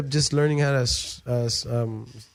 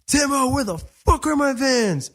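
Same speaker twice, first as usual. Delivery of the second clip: mock yell; 11 semitones higher than usual. Brother demanding his missing Vans, high slow angry call. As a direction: call out for the stolen shoes.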